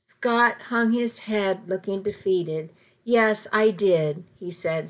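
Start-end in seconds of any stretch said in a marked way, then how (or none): none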